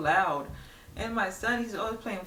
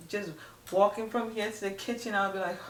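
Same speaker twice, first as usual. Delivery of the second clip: ordinary talk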